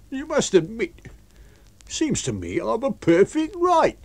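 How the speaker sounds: high-pitched aggrieved protest; protesting tramp. A narrator's reading. Tramp arguing his rights to his master, protesting. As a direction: argue in a high, pleading voice.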